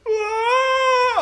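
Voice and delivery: high, loud mock crying wail; paranoid wail. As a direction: let out a long piercing cry. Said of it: "the paranoid sound".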